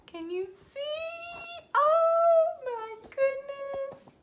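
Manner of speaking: high, slow, squealing delight; gushing. Real, not acted. Painter asking viewers to admire the lashes, high slow gush. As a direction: draw it out, swooning over the lashes.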